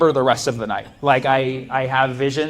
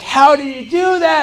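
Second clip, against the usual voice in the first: much higher, slow, loud astonished question; amazed kids; 13 semitones higher, 7 dB louder, slower than usual. Retold or imitated. Classmates stunned he solved it, high astonished voice. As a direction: gasp it out as awed classmates. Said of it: "all the kids were like".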